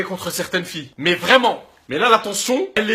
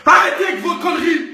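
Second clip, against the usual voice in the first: put-on ranting at girls who edit photos, yelling; angry shout; 5 semitones higher than usual. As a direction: bawl them out.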